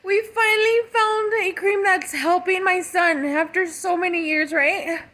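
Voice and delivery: a touch of tears, grateful mother; tearful. Real, not acted. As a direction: let the gratitude shake the voice. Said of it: "it's so emotional".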